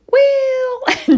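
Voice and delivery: high, loud, drawn-out squawk of well; parrot copying me. Retold or imitated. Bird copying the owner's voice, high drawn-out parrot. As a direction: belt it out like a parrot mimic. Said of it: "just in my voice".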